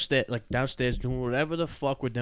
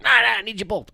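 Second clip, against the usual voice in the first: high loud dismissive dad voice; imitated insistent dad; 10 semitones higher, 8 dB louder than usual. Dad refusing to let the boys go inside: high loud insistent. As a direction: brush them off loudly, high.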